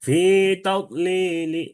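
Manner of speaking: slow chanted "তাদলিলি" with a light last i; drawn-out chant ending "তাদলিলি" on a faint short vowel; rawm recitation. Shown as a demonstration. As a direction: stretch "ফি তাদলিলি", then barely sound the final "li". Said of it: "এটাও রৌম".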